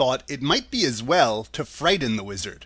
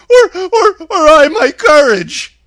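very high, loud stammering lion; panicky, high-pitched cowardly beast; 15 dB louder than usual. A narrator's reading. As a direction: yelp it out with a nervous stutter. Cowardly Lion voiced in story.

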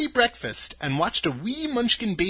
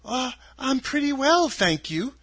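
put-on polite girl's voice, starting high; reply politely, lightly pitched up; 8 semitones higher than usual. A narrator's reading. Dorothy answering the scarecrow's greeting, polite. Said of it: "replied Dorothy politely".

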